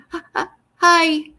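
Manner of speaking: slow, halting, struggling hi; halting speech. Acted. Jim, who struggles to speak, greeting Mark in a slow halting voice. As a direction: push the words out slowly, as if speech is hard. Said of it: "medyo hirap pala magsalita si Jim".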